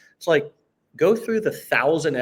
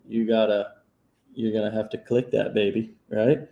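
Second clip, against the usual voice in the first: normal talking voice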